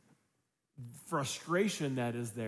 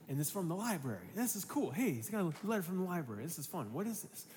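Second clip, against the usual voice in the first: put-on higher-pitched take on a delighted kid; lightly voiced eager little boy; imitating excited child; 4 semitones higher, faster than usual. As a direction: act out a five-year-old's excited curiosity. Retells young son's delight at letter.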